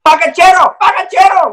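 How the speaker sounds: high, loud excited cry of pacachero; excited client yelling. Retold or imitated. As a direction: call out the word like he's spotted something.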